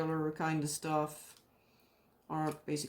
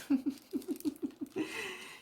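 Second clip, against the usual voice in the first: high, soft giggle trailing off; 13 semitones higher, 3 dB quieter than usual. Real, not acted. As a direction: let a high giggle fade away. Keeps laughing at herself.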